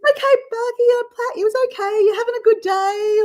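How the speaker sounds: high, soothing pet voice; baby talk. Retold or imitated. Petting her blind chicken, cooing in baby talk. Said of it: "make those baby noises that you do".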